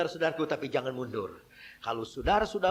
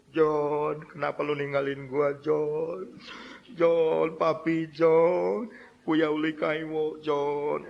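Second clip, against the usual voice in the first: sing-song widow's lament with long held vowels; mock crying; much slower than usual. Widow crying to her late husband John, mock wailing. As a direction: draw out the name like someone keening. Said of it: "ini wanita dia nangis".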